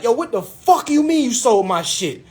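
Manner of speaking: high, indignant outburst; amped up. Retold or imitated. Client challenging agent over the sale, amped up. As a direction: retell it fired up and incredulous. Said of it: "I'm amped".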